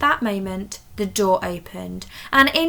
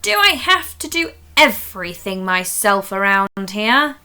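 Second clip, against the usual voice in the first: louder, fed-up complaint; a teacher done with everyone; exasperated; 8 dB louder than usual. Acted. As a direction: sigh it through annoyance.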